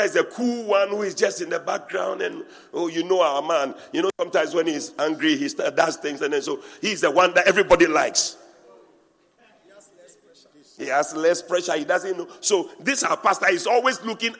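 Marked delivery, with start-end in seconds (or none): none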